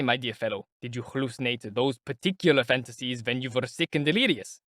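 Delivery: plain reading voice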